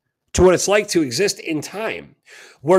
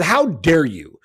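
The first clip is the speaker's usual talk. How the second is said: furious rant; 2 semitones higher, 4 dB louder than usual. Retold or imitated. Speaker retells blasting Jared Rand, loud and outraged. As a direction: tear into him, outraged.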